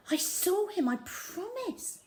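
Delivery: put-on high pleading little boy's voice; insisting child. A narrator's reading. Young Bertie insists to his doubting father, high and pleading. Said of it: "Bertie insisted".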